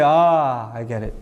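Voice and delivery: dawning realization. Acted. Student finally understanding the teacher's explanation, acted dawning realization. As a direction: let it sink in, voice falling away.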